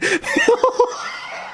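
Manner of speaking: unvoiced wheezing laugh; breathy high laugh. Real, not acted. Vlogger mocking paid patch, wheezing laugh.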